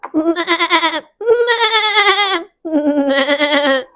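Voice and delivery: high baa-like laugh; goofy bleating giggle; bleating sheep laugh. Acted. Sheep arrive.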